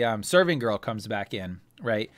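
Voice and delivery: normal talking voice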